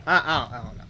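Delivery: ordinary talk, nothing marked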